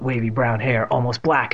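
ordinary delivery

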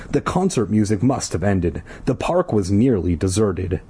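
ordinary talk

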